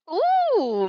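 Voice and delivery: high, loud teasing ooh; delighted gasp. Acted. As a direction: gasp with glee. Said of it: "Midnight gasped".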